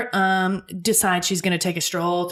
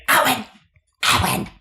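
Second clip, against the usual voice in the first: put-on nagging old mother; 6 semitones higher, 3 dB louder than usual. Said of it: "the mom from Throw Mama from the Train".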